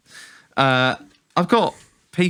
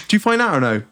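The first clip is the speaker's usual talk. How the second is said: ordinary talk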